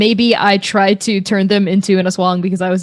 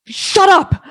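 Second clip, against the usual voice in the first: shouting; 11 semitones higher than usual. Acted. Character snapping at a villain.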